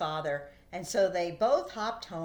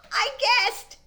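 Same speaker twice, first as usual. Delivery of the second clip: proud; 10 dB louder than usual. Acted. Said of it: "Barry said proudly".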